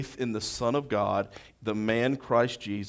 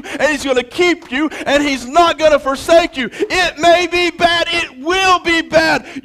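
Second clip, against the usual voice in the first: high, loud impassioned sermon delivery building to shouts; full-force preaching; 14 dB louder than usual. Acted. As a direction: preach it hard, rallying the church not to fear.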